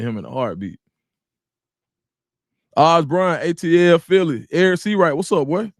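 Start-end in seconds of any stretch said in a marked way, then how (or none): 2.8-5.7 loud shout-out. Real, not acted. Shout-outs to chat viewers.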